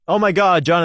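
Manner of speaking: normal talking voice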